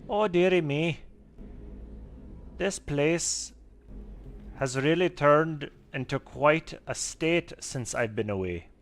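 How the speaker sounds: high, loud Scottish brogue for the gnome keeper; lilting Scottish gnome woman voice; Scottish accent. Acted. Gnome owl keeper fussing over her messy owlery, Scottish accent. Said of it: "So I'm gonna give him a Scottish accent".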